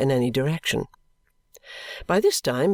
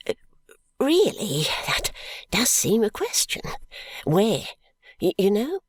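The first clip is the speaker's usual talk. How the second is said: higher, hesitant, stammering little man; wistful stammer; 7 semitones higher than usual. A narrator's reading. Timid stationer pleading with the constable, wistful stammer. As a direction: stammer timidly, lost for words.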